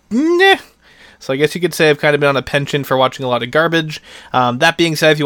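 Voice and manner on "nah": high, scoffing nah; mock dismissive nah. Real, not acted. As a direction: brush it off with a sneer. Dismissing his own movie choice.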